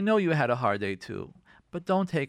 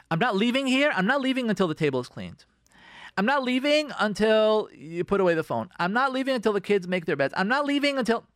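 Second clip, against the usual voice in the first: raised, insistent ultimatum; acted controlling spouse; 6 semitones higher than usual. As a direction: lay down demands like a bossy spouse. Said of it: "take control of the situation".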